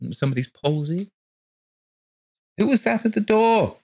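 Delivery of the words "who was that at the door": put-on children calling from bedrooms, high and louder. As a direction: call out from another room. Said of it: "called the children's voices".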